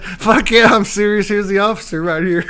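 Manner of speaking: loud, high, laughing comeback; emphatic retort. Retold or imitated. Arrested man answering his friend on the phone, loud and emphatic. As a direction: fire it back loudly through laughter.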